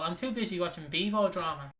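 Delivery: normal talking voice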